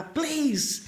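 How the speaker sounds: asking soldiers for a forklift in English; polite English plea. Retold or imitated. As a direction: ask nicely in foreign English.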